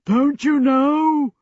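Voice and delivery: exasperated djinn booming over a din; thundering. A narrator's reading. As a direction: raise the voice to a perfect thunder. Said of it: "he thundered".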